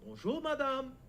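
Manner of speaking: Bonjour, madame said fully and courteously in French; the learned polite shop greeting, full French. Shown as a demonstration. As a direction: greet with the whole Bonjour, madame, not just Bonjour. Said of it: "this new tech that I learned".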